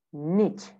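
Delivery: American "niche" as "nitch"; American accent. Shown as a demonstration. Teacher models American "niche" for students, rhyming with "pitch". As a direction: say "niche" with short I and CH. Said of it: "American accent".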